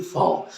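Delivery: फाओ with a soft f sound; फाओ, the letter V in German. Shown as a demonstration. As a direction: say फाओ with f, not v. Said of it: "इसमें एफ की आवाज़ निकलती है".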